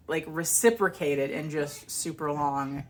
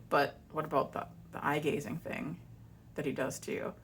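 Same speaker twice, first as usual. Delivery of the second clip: hushed suspicion; 2 semitones lower, 8 dB quieter than usual. Retold or imitated. Ex pressing suspicion.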